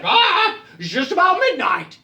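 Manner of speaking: high, loud squawk into the announcement; rooster. Acted. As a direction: crow the time like a rooster. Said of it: "And the rooster, who always knows".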